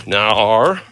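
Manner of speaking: "Nar" loud and stretched, ending on R; big drawn-out Australian "Nar". Shown as a demonstration. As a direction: exaggerate the Aussie no, "Nar". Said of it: "you gotta say nar".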